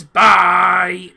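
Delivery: loud cry of bye as the ball sails off; shouted goodbye. Real, not acted. Streamer yells at a shot flying wide, loud cry. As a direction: yell it after the ball flying high. Long-range shot flies away.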